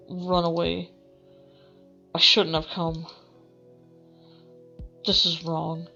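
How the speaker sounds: usual speaking voice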